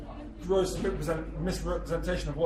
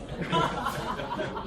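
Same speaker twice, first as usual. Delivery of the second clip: breathy villain laugh; 7 semitones higher than usual. Acted. Baron laughs after boast.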